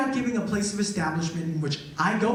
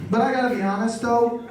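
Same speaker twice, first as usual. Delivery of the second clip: normal talking voice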